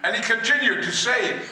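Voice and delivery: ordinary delivery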